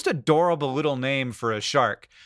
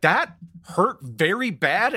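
retold pained reaction; 4 semitones higher, slower than usual. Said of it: "he was like".

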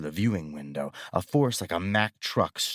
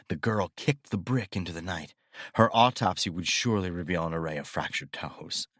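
ordinary delivery

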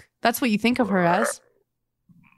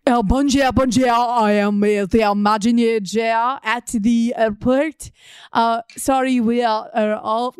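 put-on French accent; slower than usual. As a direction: play a mock French clerk. Plays French airport employee.

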